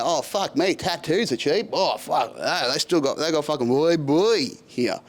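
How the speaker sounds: lightly hammed-up bogan in a bar; a hint of loutish Aussie tourist; mock bogan. Bogan marvelling in an Aussie bar, mildly mocking imitation. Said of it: "grinds my gears about Bogans".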